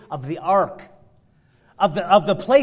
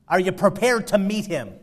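ordinary talk, nothing marked

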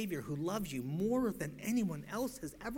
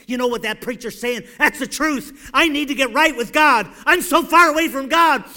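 much louder and higher preaching, shouting at the hypocrite; fiery preaching; 9 semitones higher, 20 dB louder than usual. Acted. Preacher shouting down hypocrites in the pews, fiery preaching. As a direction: thunder the warning at the sinner.

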